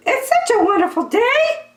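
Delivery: very high, fast, chirpy question; a tiny bird piping up; squeaky bird voice. Acted. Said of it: "asked Marabou".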